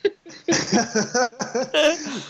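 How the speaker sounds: high, giggling burst; helpless laugh. Real, not acted. GM collapses into laughter before the coachman's name. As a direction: cracks up completely. GM cracks up mid-quote.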